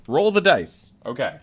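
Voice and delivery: ordinary talk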